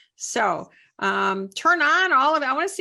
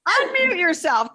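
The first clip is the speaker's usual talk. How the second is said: mock order, higher and louder; louder playful command; 7 semitones higher, 4 dB louder than usual. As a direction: push the command harder. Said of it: "I order you".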